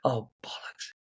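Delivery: soft, half-whispered oh bollocks; a quiet, exasperated mutter; muttered curse. Real, not acted. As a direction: swear under the breath at yourself. Forgot what to say.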